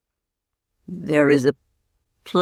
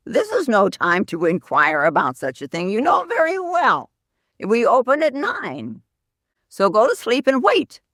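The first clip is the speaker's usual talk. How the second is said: higher, put-on voice of a grumpy bartender; mock-irritated bartender voice; annoyed bartender; 4 semitones higher than usual. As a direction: scold the caller like a woken bartender. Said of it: "his bartender was annoyed".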